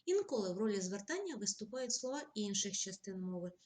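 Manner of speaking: usual speaking voice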